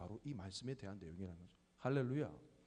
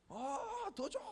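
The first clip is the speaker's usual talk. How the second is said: put-on high toddler whine for more; toddler whining; much slower than usual. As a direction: beg like a small child. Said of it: "두유 하나 더 달래요".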